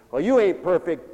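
usual speaking voice

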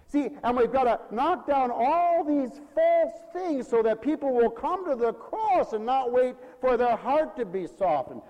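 loud, high, urgent exhortation; forceful preaching. Real, not acted. Pastor urges knocking down false things, forceful preaching. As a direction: press the point hard from the pulpit.

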